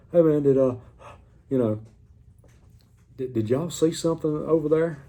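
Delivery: gasping for air between words, still panicked; out of breath. Retold or imitated. Kayaker calls breathlessly to the pontoon boat, out of breath. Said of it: "I was so out of breath".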